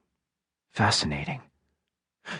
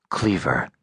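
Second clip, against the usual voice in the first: かなり低く抑えた男の声; 喉の奥から低く沈んだ声で; low voice; 7 semitones lower, 3 dB quieter than usual. A narrator's reading. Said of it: "said Low Voice".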